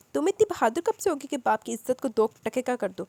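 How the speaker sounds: a mild narrated shout at them. Acted. Haya shouts at her sisters, moderately loud. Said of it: "وہ چلائی".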